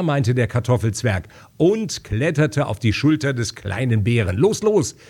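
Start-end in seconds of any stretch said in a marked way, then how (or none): 4.4-5.0 high-pitched, eager command from the dwarf; urging on. A narrator's reading. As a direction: spur him on with raised pitch. Dwarf urges bear onward. (antreibend gerufen)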